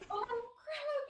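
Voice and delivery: very high-pitched dismayed cry; acted-out dread, voice shooting up; alarmed reaction. Passenger hearing what the driver hit, alarmed reaction.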